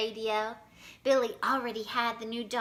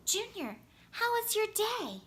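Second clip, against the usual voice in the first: higher, gentle mom voice; a hint of a mom calling; 10 semitones higher than usual. A narrator's reading. Mom tries to reach daydreaming Junior, lightly higher. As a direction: call him back from his daydream. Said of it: "Mom tried to get his attention".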